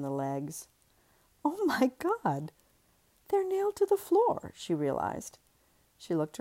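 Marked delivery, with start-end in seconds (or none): 1.4-4.5 high shocked realization. Acted. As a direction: gasp it out in disbelief. Shocked discovery.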